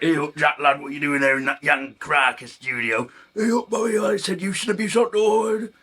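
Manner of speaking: high, hammed-up Yorkshire banter; Yorkshire accent. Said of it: "the Yorkshire accent".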